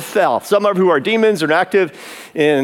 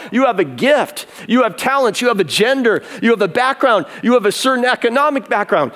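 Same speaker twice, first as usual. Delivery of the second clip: rising fervent preacher's exhortation, pitched up; wound-up preaching; 6 semitones higher than usual. Real, not acted. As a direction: press each "You have" like a charge to the flock. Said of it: "preacher's getting a little wound up".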